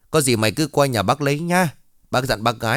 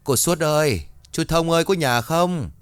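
higher calls into the silent house; calling out; 6 semitones higher than usual. Acted. Ông Kha gọi chủ nhà, higher anxious calling. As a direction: call for the owners, a little anxious. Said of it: "Ông Kha cũng gọi phụ Hiền".

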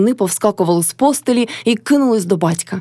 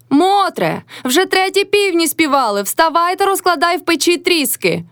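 high-pitched nagging wake-up call; 9 semitones higher than usual. A narrator's reading. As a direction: order her up in a high voice. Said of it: "закричала з печі на Мотрю".

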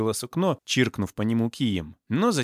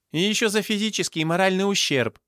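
high-pitched demanding cry; 9 semitones higher, 3 dB louder than usual. A narrator's reading. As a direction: pipe up high, demanding compensation. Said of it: "вскрикнула худая девушка". (вскрикивает высоким голосом, требуя)

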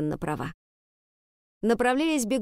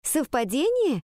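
high, disbelieving "совпадение"; incredulous echo question; 6 semitones higher, slower than usual. A narrator's reading. Pupil querying the teacher's explanation, incredulous. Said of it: "переспросила Ариадна".

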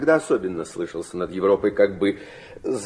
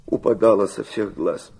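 ordinary talk

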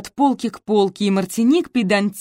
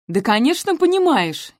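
higher, smug know-it-all; 6 semitones higher than usual. A narrator's reading. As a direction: pronounce it like you know best. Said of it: "изрекла она тоном всезнайки".

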